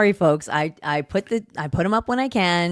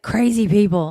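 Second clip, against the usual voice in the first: louder imitation of the press speaker; mock outburst; 3 semitones higher, 7 dB louder than usual. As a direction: blurt it out, mimicking her emphasis. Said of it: "she's like".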